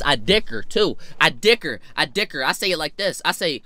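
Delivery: ordinary talk, nothing marked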